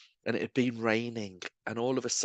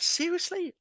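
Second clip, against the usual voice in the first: incredulous reaction. Retold or imitated. Stranger reacting to being told of God's love, imitated disbelief. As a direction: act out a taken-aback stranger. Voicing people's surprised response.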